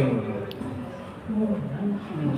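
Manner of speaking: ordinary delivery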